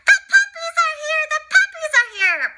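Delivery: Nanny calling out the big news; nanny shouting. Acted. Nanny shouts to the family the puppies have arrived, loud high excited. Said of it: "the nanny shouted".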